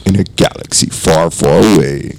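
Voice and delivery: low, ominous trailer narrator; mock epic narrator opening a horror tale; movie trailer voice.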